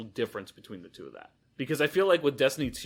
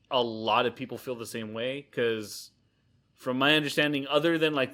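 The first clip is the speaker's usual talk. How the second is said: usual speaking voice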